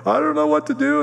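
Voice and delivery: very high-pitched imitation of tearful wailing; mock crying. Crying wife answering her mad mother, high mock wail. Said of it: "and Bobby's crying, and she's like".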